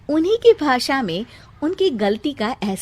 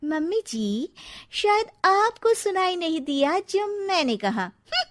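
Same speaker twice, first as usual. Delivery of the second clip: sassy retort; 9 semitones higher than usual. Acted. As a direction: throw her words back at her, smug. Cheeky retort to mother-in-law.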